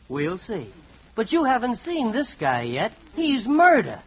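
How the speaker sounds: usual speaking voice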